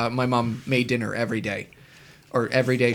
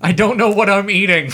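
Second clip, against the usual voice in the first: higher, louder, laughing through it; laughing stomach voice; 7 semitones higher, 6 dB louder than usual. Pretend.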